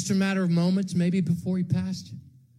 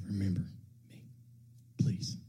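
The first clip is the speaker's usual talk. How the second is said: soft, low dying plea; quiet plea; 12 semitones lower, 13 dB quieter than usual. Retold or imitated. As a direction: beg it quietly.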